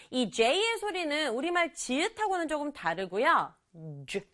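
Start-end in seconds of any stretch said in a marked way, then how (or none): 4.1-4.2 "주" said with lips pushed together; teacher shows learners the English J, "주" with gathered lips. Shown as a demonstration. Teacher demonstrating the J, lips gathered on "주". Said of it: "이렇게 모아서".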